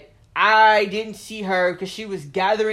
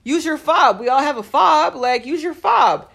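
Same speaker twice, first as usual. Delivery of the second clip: exasperated retort; 6 semitones higher, 8 dB louder than usual. Retold or imitated. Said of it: "I said".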